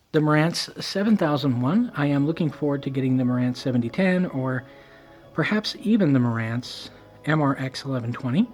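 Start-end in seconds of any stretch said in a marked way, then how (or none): none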